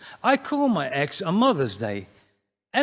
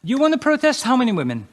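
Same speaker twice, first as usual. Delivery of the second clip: police officer voice; 9 semitones higher, 6 dB louder, faster than usual. Acted. Police voiced in a comedy bit.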